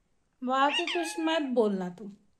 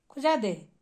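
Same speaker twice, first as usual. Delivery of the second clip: normal talking voice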